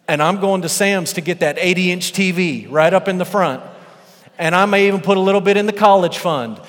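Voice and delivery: loud, high, gleeful daydreaming; rising glee about cashing in; giddy excitement. Retold or imitated. Workers dreaming of their payout.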